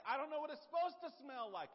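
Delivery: loud, high, exasperated yell; frustrated shout back to wife; exasperated call. Retold or imitated. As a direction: throw up your hands as you shout. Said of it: "I'll say".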